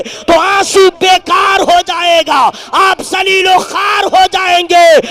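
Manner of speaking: very loud, high, slow oratory; sustained chanting sermon shout; full-force preaching. Real, not acted. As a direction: thunder it out to the crowd, stretching the words.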